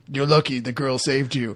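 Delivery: low, soft mock tough-guy line; a hint of a stern hitman. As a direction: mutter it like a cold hitman. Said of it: "he says".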